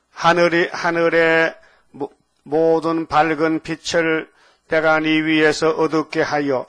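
slow, loud monotone recitation; scripture reading. Acted. Preacher reading the verse to the congregation, loud measured intoning. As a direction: intone the verse slowly and forcefully on one note.

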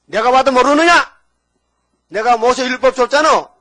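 loud, high outburst; indignant rebuke. Retold or imitated. Jesus to people who fail to recognise him, indignant loud rebuke. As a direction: shout it as an exasperated rebuke.

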